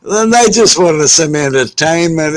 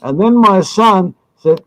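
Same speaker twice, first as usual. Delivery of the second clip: plain reading voice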